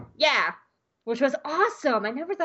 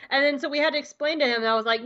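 normal talking voice

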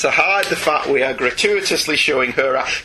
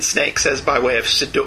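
ordinary talk, nothing marked

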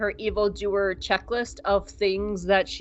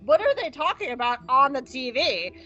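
high, excited mock Cyril; Cyril freaking out; 6 semitones higher than usual. Recapping Andor, voicing Cyril shrieking at his mother about the TV. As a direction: squeal it like an overexcited grown son. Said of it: "Cyril's like".

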